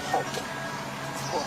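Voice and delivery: normal talking voice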